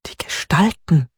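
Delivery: „Gestalten“ whispered, breath only, falling at the end; whispering. A narrator's reading. Hannah whispers her answer to Peter, breathy whisper. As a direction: hush „Die Gestalten“ so nobody overhears.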